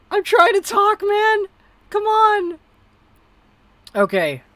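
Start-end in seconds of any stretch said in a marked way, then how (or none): 0.1-2.6 very high, loud fed-up yell; exasperated shouting. Real, not acted. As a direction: plead angrily for the hiccups to quit. Yelling at own hiccups.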